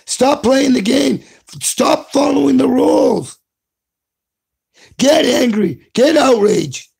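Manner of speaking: rally them, each order pushing harder; rising exhortation. Real, not acted. Ranting at the hosts, urging them to stop following rules.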